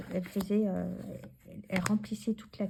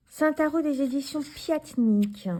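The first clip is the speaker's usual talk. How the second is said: usual speaking voice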